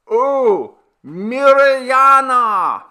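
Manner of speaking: long, slow, very high, loud call; shout at top of lungs. A narrator's reading. Villager yelling at the top of his lungs to a deaf old woman. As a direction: bellow her name as far as it goes. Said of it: "shouted Perko at the top of his lungs".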